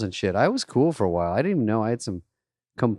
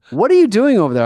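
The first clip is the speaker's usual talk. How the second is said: higher, louder barked question; angry manager yell; 11 semitones higher, 8 dB louder, faster than usual. Retold or imitated. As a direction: call across the room at the busboy. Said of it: "And he goes".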